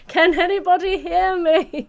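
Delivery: high, laughing call; laughing Titanic quote. Retold or imitated. Host repeats the Titanic line, high and laughing. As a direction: break into laughter while calling. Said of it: "that moment of Titanic".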